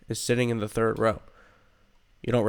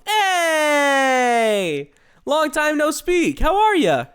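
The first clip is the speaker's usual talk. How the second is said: put-on high, excited hello to an old friend; bubbly greeting; 5 dB louder, much slower than usual. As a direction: squeal a delighted hello.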